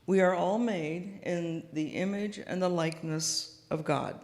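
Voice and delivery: ordinary talk, nothing marked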